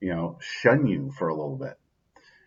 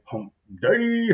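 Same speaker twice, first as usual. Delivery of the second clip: much higher, squeaky put-on echo; silly high-pitched hump day call; playful hump day. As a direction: toss it back in a goofy high voice.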